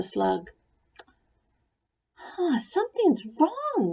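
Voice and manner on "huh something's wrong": high, puzzled huh. A narrator's reading. Ruby sure something is off, puzzled. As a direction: frown as the count goes wrong. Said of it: "said Ruby".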